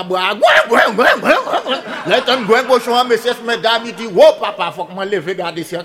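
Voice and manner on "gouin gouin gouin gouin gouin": squealing oink-oink sounds, half laughing; imitated pig squeal. Storyteller imitates the pig squealing in the woods, laughing. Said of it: "un cochon qui a crié".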